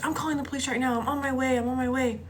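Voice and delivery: high, frantic mother voice; screaming mom. Retold or imitated. Mom tells daughter help is coming, mock frantic scream. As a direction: imitate an alarmed mom, without full volume. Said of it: "she's, like, screaming".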